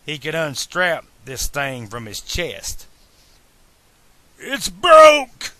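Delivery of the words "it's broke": high, loud wail of pain; pained outlaw howl, pitched way up. A narrator's reading. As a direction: cry out in agonized disbelief. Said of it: "Buford wailed".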